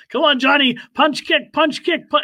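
high, chanting mock shouts; imitated ringside coaching. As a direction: egg him on like a pest at ringside. Imitating manager's repeated ringside shouting.

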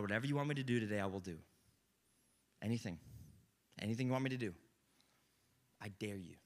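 low, soft surrendering prayer; murmured prayer of surrender; quiet prayer. Acted. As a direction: pray it under your breath at the bedside. Acted morning prayer, low quiet.

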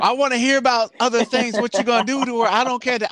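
loud, high, comic exasperation; mock rant. Host scolds country singers over their lyrics, mock rant. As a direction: raise your voice in a playful complaint.

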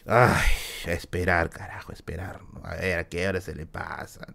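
low, soft grumble of resignation; mock fed-up muttering under the breath; weary waiting. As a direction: sigh it out, trailing into a tired mumble. Acting out bored waiting students.

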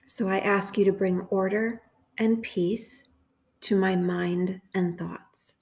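slow, measured prayer with long pauses; guided meditation prayer. Acted. Host praying phrases for listeners to repeat, slow guided meditation. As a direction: say each phrase calmly and unhurried, leaving room to repeat.

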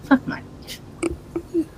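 breathy whispered curse; exasperated whisper. Real, not acted. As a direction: whisper it in despair. Player exasperated at failing shelter.

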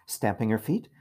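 ordinary delivery

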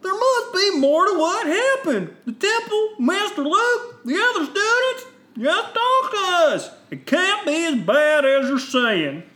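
very high, loud, slow plea; pleading Jedi. Acted. Three Jedi beg Ben to explain, high pleading voice. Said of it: "grouping all three of these Jedi".

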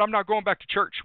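a half-retold angry vow to God; defiant outburst. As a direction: throw it back at God, stubborn.

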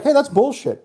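calling someone out. Acted. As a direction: step up and call it out.

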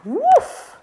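squeaky high woof; high dog bark. Acted.

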